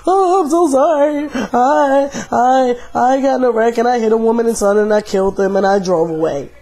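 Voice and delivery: high, wailing mock sob of a guilty man; crying confession. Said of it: "he's screaming and crying".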